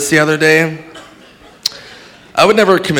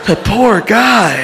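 higher, slow lament; acted shocked pity; 5 semitones higher, slower than usual. Witness pities man who killed himself, slow higher lament. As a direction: draw it out in mock pity.

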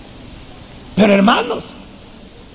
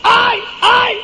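mock shriek of alarm, far higher and piercing; grito fingido; much slower than usual. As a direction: play the accusing wife crying out in fake distress. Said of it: "ella se puso a gritar". (grito agudo fingido de mujer)

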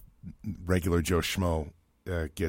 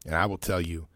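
usual speaking voice

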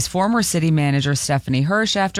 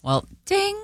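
chime imitation; 4 dB quieter than usual. Mimics seatbelt chime.

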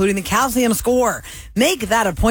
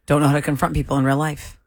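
ordinary delivery

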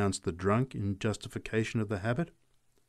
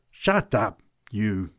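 lightly growled order; slower than usual. A narrator's reading. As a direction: warn him off with a touch of menace. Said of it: "growled the big woman".

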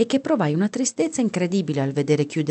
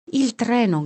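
higher-pitched, mildly excited exclamation; joyful cry; 6 semitones higher, slower than usual. A narrator's reading. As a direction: call out the discovery with contained joy. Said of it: "gridò Melo, saltando fuori pazzo di gioia".

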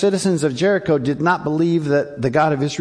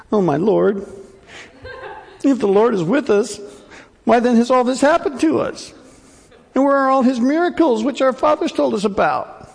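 high, whiny voice of hopeless despair; despairing Gideon; 7 semitones higher than usual. Acted. Whining high complaint. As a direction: pastor to congregation, acting Gideon's whiny lament. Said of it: "You guys hear Gideon's tone?".

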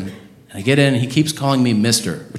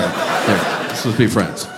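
mock make-up; 5 semitones lower, 5 dB quieter, slower than usual. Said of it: "that was a little aggressive".

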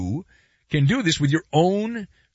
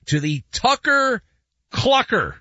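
Tucker and Clucker set apart, higher; said very slowly; 7 semitones higher than usual. Shown as a demonstration. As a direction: pause between Tucker and Clucker for care. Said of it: "Say that very slowly".

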